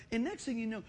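ordinary delivery

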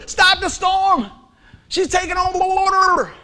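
high, loud, slow frightened cry; a desperate sailor's wail; panicked plea. Retold or imitated. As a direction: beg for the storm to stop as water floods in. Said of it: "we go".

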